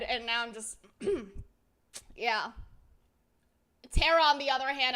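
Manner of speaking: normal talking voice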